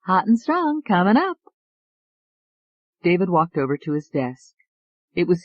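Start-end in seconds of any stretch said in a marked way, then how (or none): 0.1-1.4 high, eager secretary; lightly voiced young woman. A narrator's reading. Secretary cheerfully answers.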